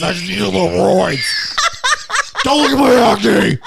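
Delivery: loud, high hammed-up shout; mock steroid-fuelled bellow, climbing; roid-rage bird. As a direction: yell it like a pumped-up bodybuilder, half laughing.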